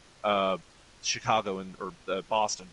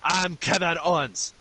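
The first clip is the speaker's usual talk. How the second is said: mock Quebec French accent, loud and slow; high, drawn-out caricature of a Quebec French accent; Kevin Owens; 6 semitones higher, 5 dB louder than usual. Fan mocking a wrestler introducing himself, exaggerated Quebec French accent. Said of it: "He's got a French fucking accent".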